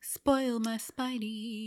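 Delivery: slow teasing sing-song; playful slow drawl. Pretend. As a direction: draw it out playfully. Playful pun on spoilers.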